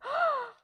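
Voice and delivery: loud, high mock gasp; shocked gasp. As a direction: gasp like a whole stunned crowd. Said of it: "Everyone's like".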